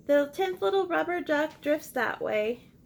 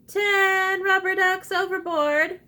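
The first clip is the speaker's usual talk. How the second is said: louder, higher shout of alarm; captain's call; 6 semitones higher, 7 dB louder than usual. Acted. Teacher voicing the captain calling out. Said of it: "calls the captain".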